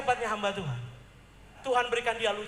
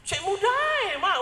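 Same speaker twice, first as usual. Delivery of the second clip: put-on teasing child; 9 semitones higher, 6 dB louder than usual. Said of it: "dia bilang".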